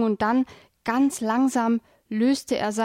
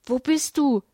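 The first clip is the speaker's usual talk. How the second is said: concerned, higher-pitched call into the dark; a raised, worried question; 4 semitones higher, 3 dB louder than usual. A narrator's reading. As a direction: call out anxiously for a missing friend. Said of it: "die besorgte Stimme von Kali rufen".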